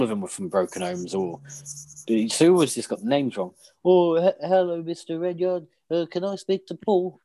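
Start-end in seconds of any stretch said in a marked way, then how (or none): 3.8-7.2 put-on high, nervous polite kid voice; a shy child calling a friend; polite kid on phone. Retold phone call as kid.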